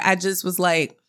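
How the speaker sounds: usual speaking voice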